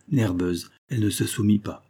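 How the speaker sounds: plain reading voice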